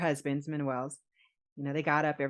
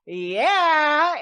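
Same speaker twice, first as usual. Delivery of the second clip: excited yes; 9 semitones higher, 10 dB louder than usual. Retold or imitated. Rob accepts the proposal.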